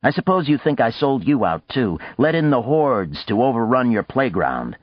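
a touch of defensive snapping; snapped. A narrator's reading. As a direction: throw the accusation out with restrained irritation. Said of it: "he snapped".

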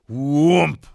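harder thump. A narrator's reading. Hare jumps again, harder, booming thump. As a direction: hit the impact hard and loud.

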